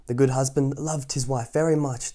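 ordinary delivery, nothing marked

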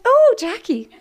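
high, loud, laughing "Oh, Jackie"; a nun beaming at a pupil; delighted nun. Retold or imitated. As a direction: gush like a pleased teacher.

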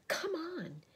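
put-on high, insistent girl's voice; urgent girl. A narrator's reading. Lucy pulls her sister around, urgent high voice. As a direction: urge her along, pitched high. Lucy urges Susan to turn.

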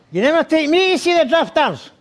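high nasal nagging; mock-nagging high nasal voice; nasal wife. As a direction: imitate a complaining wife, high and through the nose. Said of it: "My wife talks through her nose".

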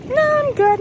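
squeaky polite no; mock-chirpy refusal; high, bright brush-off. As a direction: turn him down sweet and high. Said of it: "And I was like".

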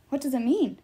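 mildly insistent question, pitch lifted; demanding. A narrator's reading. Percy asks Apollo about the prophecy, demanding. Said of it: "I demanded".

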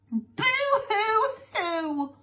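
high, long drawn-out boo-hoo; hammed-up storybook wail; mock weeping. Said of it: "weep some more".

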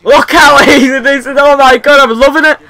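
loud, high-pitched excited crowing; gleeful gloating. Real, not acted. Gamer winning easily, gleeful high shouting. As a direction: crow over an easy win.

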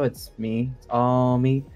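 ordinary talk

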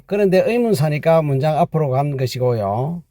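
normal talking voice

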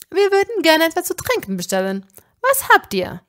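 high, fast and cheery; bubbly host ordering for everyone; party friend ordering drinks, high and chirpy; excited. Acted.

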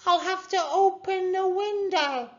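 very high, sustained call; stretched, high-pitched shouting; crying out. A narrator's reading. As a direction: holler through a closed window. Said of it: "cried Mags".

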